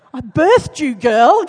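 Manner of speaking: loud, indignant mother's retort; mock outrage at a stubborn daughter; playful loud protest. Mother to daughter who won't help, loud mock indignation.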